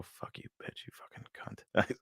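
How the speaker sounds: hissed insults whispered right at the doll; nasty whisper. Retold or imitated. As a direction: lean in close and whisper the insults viciously. Taunting the haunted doll up close.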